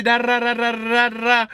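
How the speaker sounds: mock ranting mother. Mother ranting, gibberish filler.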